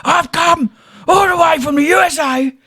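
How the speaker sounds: high, wailing mock lament, laughing; imitating a crying tourist. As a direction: whine it like a heartbroken American. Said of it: "Makes he scream and he goes".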